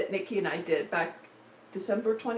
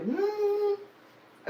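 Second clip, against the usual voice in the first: high, skeptical hum of a judging friend; a pitched-up doubtful hmm; disapproving hmm; 13 semitones higher than usual. Retold or imitated. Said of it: "Nikki's at the computer now going".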